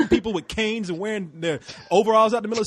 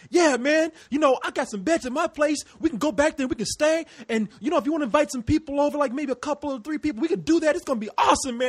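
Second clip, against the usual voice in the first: much higher, eager and bright promises; enthusiastic invitation; 9 semitones higher than usual. Retold or imitated. Nick inviting his friend over, much higher eager voice. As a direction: pitch way up, overselling the plan. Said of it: "Like, like".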